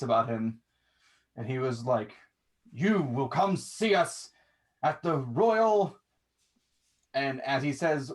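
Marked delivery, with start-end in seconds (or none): 2.7-6.0 stern command. Acted. Stoic lieutenant commands.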